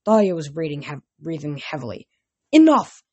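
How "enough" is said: loud, higher commanding shout; shouted order. A narrator's reading. Counselor stopping the brawl, loud order. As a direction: bark the order to stop the fight. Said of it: "Karen ordered".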